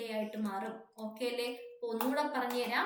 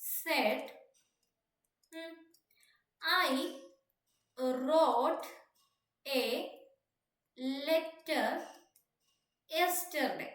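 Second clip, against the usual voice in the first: word by word with long pauses; slow dictation; much slower than usual. Real, not acted. Teacher writing out a question for students, slow dictation. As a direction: dictate it slowly as you write.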